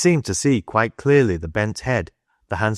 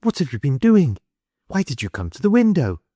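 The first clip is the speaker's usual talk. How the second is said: higher-pitched, voiced woman's questioning; 7 semitones higher than usual. A narrator's reading. Woman to boy caught at the window, high questioning.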